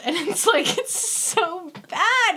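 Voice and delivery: high, slow laughing wail; real laughing groan. Real, not acted. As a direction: cry out through laughter at the cringe. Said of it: "laughing at the bad line".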